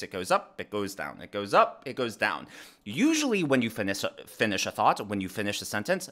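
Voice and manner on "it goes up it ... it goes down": "up" on a rising pitch, "down" sinking low each time; a simple sing-song demo of pitch going up and down. Shown as a demonstration. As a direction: trace the rise then the drop with your voice. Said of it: "think about the pitch of your voice".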